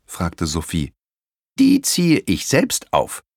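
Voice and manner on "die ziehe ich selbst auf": higher, slow, proud granny voice; a grandmother beaming with pride, slowly; proud grandma. Acted. Grandma telling the children she raises dinosaurs, proud and slow. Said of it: "antwortete Oma stolz".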